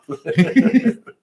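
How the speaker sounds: a short, high rising laugh at her own idea; bright laugh. Real, not acted. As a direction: laugh it off, amused.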